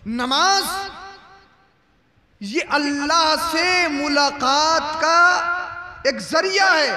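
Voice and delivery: slow, stretched, ringing words with long pauses; declamatory preaching. Real, not acted. Preacher telling the crowd what prayer means, declamatory preaching. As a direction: draw out each phrase for weight.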